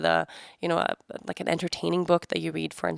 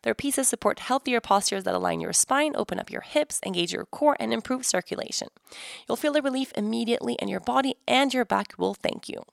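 bright, upbeat sponsor read; 4 semitones higher than usual. Acted. Host reading a sponsor ad to listeners, upbeat. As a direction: sell the chair to listeners.

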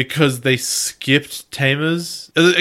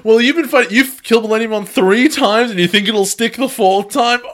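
taunting; 8 semitones higher, 4 dB louder than usual. Retold or imitated. Retold taunting comeback.

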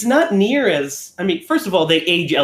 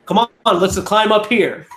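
lightly acted, higher-pitched coaxing; playful mock urging; offhand mock invitation; 4 semitones higher, 3 dB louder than usual. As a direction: mimic him casually urging someone along. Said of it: "it kinda comes off just like, he's like".

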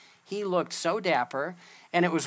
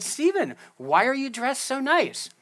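pitched way up, louder, amazed at his son; surprised question; 8 semitones higher, 4 dB louder than usual. Retold or imitated. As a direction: ask it higher and louder, caught off guard. Father surprised by dressed-up son.

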